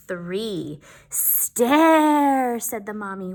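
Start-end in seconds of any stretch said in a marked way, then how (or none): 1.1-2.6 louder, higher zombie mother voice. Acted. Zombie mother to her little zombies, eerie. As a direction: drag it out like a zombie.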